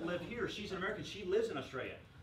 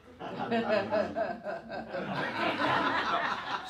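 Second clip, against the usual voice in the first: loud burst of laughter at his own joke; big real laugh; hearty laugh; 6 semitones higher, 9 dB louder than usual. Real, not acted.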